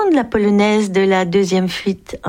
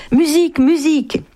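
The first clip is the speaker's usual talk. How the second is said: excited shout; 9 semitones higher than usual. Acted. Acrobat begging for music for his daughter, high shout. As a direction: yell it up to the window, pleading.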